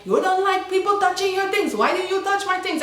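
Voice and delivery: high-pitched mocking complaint; mimicked angry housemate. Spanish girl confronts the speaker over the dryer, mocking imitation. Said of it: "She was like".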